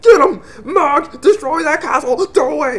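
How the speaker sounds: high, strained commander's yell; mock high-pitched war cry; shouted battle command. Villain orders an attack.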